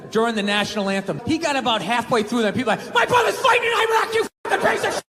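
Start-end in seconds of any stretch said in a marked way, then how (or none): 2.9-5.0 mock angry heckler. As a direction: scream it like an enraged fan. Angry fan yelling.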